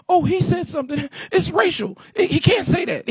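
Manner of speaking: mocking whiny voice. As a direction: imitate a touchy complainer.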